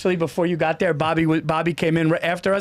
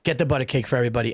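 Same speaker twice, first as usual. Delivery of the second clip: quoting Bobby; 7 semitones lower, much faster than usual. Retold or imitated. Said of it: "and he goes".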